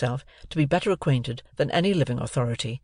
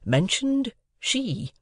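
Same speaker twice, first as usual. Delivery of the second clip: slow, higher hesitant voice; polite hint; 9 semitones higher, much slower than usual. A narrator's reading. Joe politely hinting to his wife. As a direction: drag it out timidly. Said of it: "Joe politely hinted".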